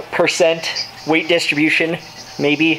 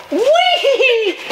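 excited whee. Retold or imitated. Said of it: "I just go fast and go".

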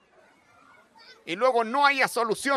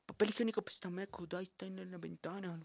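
quiet mumbled student; 8 semitones lower, 22 dB quieter than usual. Retold or imitated.

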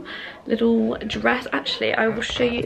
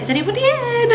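high, louder call into the flat; 12 semitones higher, 4 dB louder than usual. Retold or imitated. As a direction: sing it out to the empty kitchen. Opening the door, calling out.

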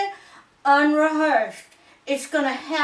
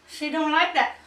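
ordinary talk

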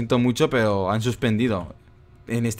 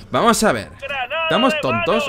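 higher, incredulous rebuke; indignant scolding; 11 semitones higher, 4 dB louder than usual. Real, not acted. Player scolding the level designers, indignant. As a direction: demand an answer in real exasperation.

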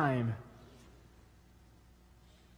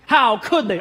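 a loud, mock-outraged cry; an online commenter in mock outrage; all caps; 4 semitones higher, 8 dB louder than usual. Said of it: "All caps".

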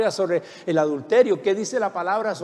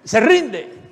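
brother demanding surrender; 4 semitones higher, 6 dB louder than usual. Retold or imitated. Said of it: "y decía".

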